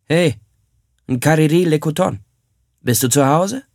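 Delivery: ruft laut zur Hütte hinüber; lauter, etwas höherer Ruf von draußen. Acted. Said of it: "rief er". (Mann ruft von draußen nach dem Hausherrn, laut rufend)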